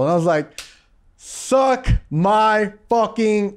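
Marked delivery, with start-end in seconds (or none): 1.2-3.6 very slow, word by word gloating; mock triumphant retort. Actor gloating after director calls him natural, mock triumphant. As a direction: stretch out each word as a cocky retort.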